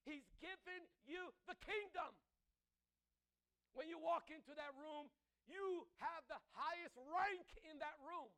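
full-force sermon build; fiery preaching. Acted. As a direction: drive it home to the congregation.

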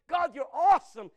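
loud, high cry of worship; Worthy slowed and shouted. Retold or imitated. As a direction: lift the praise up to God. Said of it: "He's like".

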